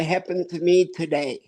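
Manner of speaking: ordinary delivery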